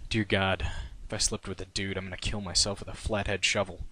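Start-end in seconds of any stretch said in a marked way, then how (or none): none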